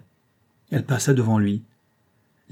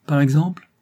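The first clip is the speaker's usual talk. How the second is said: short, higher, louder challenge; curt, almost aggressive; 5 semitones higher, 5 dB louder than usual. A narrator's reading. Rose challenging André, brief and almost aggressive. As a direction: bite the words off, nearly hostile. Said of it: "d'une voix brève, presque agressive".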